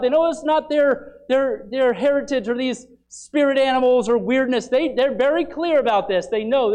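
loud, high, driving sermon delivery; forceful preaching. Acted. Preacher thundering at the congregation about the Lamb.

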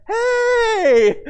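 long, high, loud holler; drawn-out excited shout of greeting; family calling back. Retold or imitated. Wife and kids answering dad across the station, high holler. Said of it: "my wife and my kids are like".

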